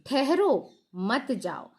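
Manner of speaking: slow ठहरो, a clear break, then मत जाओ; pause after ठहरो. Shown as a demonstration. Teacher showing how the comma is known, pause after ठहरो. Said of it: "इस comma से".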